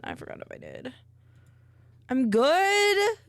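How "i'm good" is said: chirpy. Real, not acted. Answering chat cheerfully.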